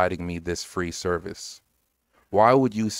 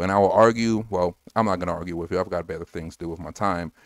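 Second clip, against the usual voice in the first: ordinary delivery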